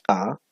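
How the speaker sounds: "Ta" popped sharp with a throat catch; glottalized ta. Shown as a demonstration. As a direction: glottal burst on "Ta". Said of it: "explosivo esta vez, o glotalizado". ("Ta" glotalizada, explosiva)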